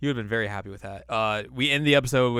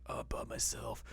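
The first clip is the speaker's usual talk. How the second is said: voiceless whisper, lonely; 11 dB quieter than usual. Retold or imitated. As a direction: whisper it to yourself. Voicing lonely Koga.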